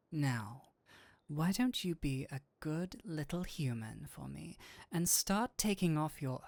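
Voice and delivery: low, sultry demon purr; a seductive demon, low and close; seductive incubus. Acted.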